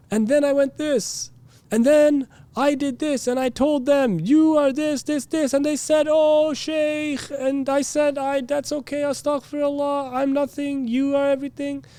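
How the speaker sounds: high, sustained preacher boasting; proud-claiming preacher. Acted. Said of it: "sometimes it involves making proud claims".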